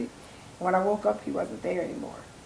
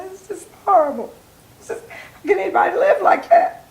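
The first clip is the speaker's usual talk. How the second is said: retold distress; 10 semitones higher, 6 dB louder than usual. Retelling crying to her daughter.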